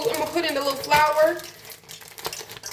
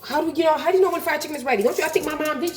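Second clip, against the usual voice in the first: ordinary talk, nothing marked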